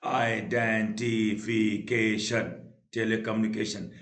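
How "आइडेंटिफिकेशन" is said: Identification slow, every syllable stretched. Shown as a demonstration. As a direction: intone identification evenly, beat by beat. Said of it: "बार बार टूट रहा".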